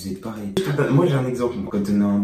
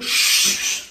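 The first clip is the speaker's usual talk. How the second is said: whispered shush; 6 dB louder than usual. Real, not acted. Hushing his partner.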